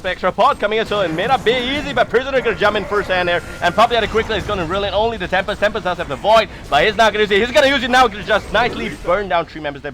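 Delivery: loud, high hype call; excited play-by-play. Real, not acted. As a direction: shout the teamfight as it happens. Teamfight play-by-play.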